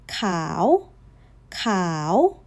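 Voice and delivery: khao dipping then lifting like a question; rising khao for the ear test. Shown as a demonstration. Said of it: "It rose".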